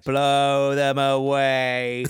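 slow, drawn-out gloating build; mock-triumphant anticipation. Imagining her expecting a payoff, mock-triumphant. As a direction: stretch it out like a grand secret plan. Imagining the auditionee's secret thought.

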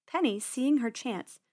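ordinary delivery, nothing marked

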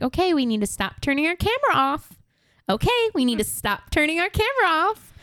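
high, sweet, patient classroom voice; calm teacher voice. Retold or imitated. Teacher asking kids on camera, sweet and high. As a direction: coax the class gently, no edge. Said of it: "most calm voice".

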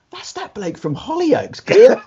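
high-pitched, drawn-out double take; retold surprised recognition. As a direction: act out suddenly recognising a face.

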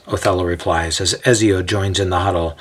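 usual speaking voice

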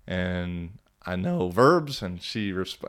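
usual speaking voice

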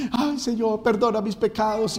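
high, mock-pleading penitent; súplica imitada. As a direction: imitate a sinner begging forgiveness. Mimics a penitent begging forgiveness. (ruego agudo imitado)

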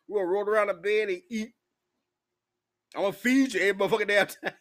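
hammering home the rejection, half joking; retold loud refusal. Telling the Chicago date it won't work, loud and emphatic.

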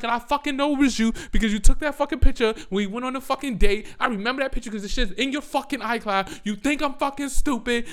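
fast, high, loud tirade; acted furious comeback. Woman re-enacting confronting boyfriend with proof, furious loud rant. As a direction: pile on the proof in a heated re-enactment.

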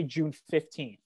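ordinary talk

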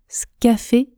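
"Scafé" starting straight with sk; dropped E. Shown as a demonstration. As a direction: contract "Scafé" into two syllables. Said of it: "je prononce «scafé»". (« Scafé » sans le E)

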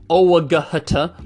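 "owaghta" slowly spelled out in sound; every letter sounded. Shown as a demonstration. Teacher mocking a letter-by-letter reading, slow and spelled out. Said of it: "We don't say".

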